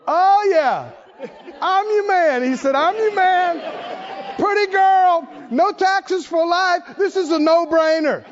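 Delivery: eager, laughing. Acted. David eagerly accepting the reward.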